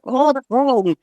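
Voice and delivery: nitpicking objection. Retold or imitated. Critics in audience correcting speaker.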